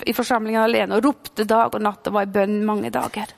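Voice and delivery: normal talking voice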